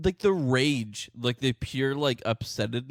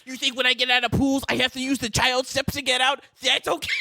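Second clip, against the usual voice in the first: higher-pitched, louder indignant parody; mock ranting short man; 9 semitones higher, 4 dB louder than usual. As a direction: ham up the furious little man's outrage.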